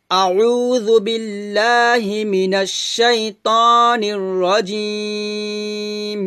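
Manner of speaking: slow, drawn-out melodic tajweed recitation; Quran recitation. Shown as a demonstration. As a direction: recite it slowly with a melodic chant. (ধীর সুরেলা কুরআন তিলাওয়াত)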